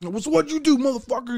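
inmate bully. Retold or imitated. Inmate interrogating kids.

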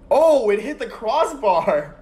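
high, loud, slow amazement; astonished drawn-out exclaim; stunned disbelief. Real, not acted. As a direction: drag it out in shock. Kick hits the crossbar.

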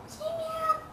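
little squeaky-high prompt; high kitten-coaxing voice. Pretend. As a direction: coax a kitten in a tiny high voice. Coaxing kitten in rising pitch.

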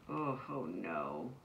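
low slow mock groan of despair. A narrator's reading. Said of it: "Ava groaned".

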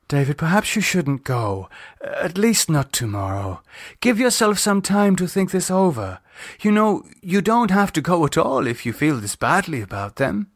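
lightly voiced woman, gently urging; Lisa, pleading. A narrator's reading.

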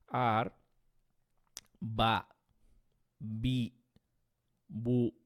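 বা বি বু said loud, lips opening each time; open syllable demo. Shown as a demonstration. Teacher contrasts open syllables with আব. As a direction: let each বা বি বু burst open. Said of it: "এভাবে যখন আমি বা, বি, বু বলছি".

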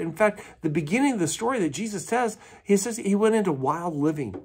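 ordinary delivery

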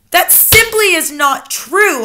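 high, loud, slow protest; indignant cry. A narrator's reading. Child denying grandmother's claim, crying out. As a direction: hammer out the denial at full voice. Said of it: "I cried".

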